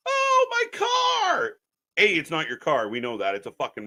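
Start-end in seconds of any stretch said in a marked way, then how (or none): 0.1-1.5 very high drawn-out whimper-shout; mock crying wail. Streamer mocks a sixty-year-old crying over his car, whiny wail. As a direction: blubber it like a grown man crying.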